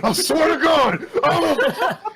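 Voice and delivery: high, loud raging yell; furious shout. Acted. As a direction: roar it out in fury. Franz raging before turning bear.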